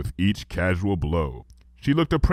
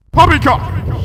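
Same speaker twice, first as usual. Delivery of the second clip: high, loud indignant shout; 8 dB louder, slower than usual. A narrator's reading. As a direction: bark it out in royal outrage. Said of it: "shouted Luna".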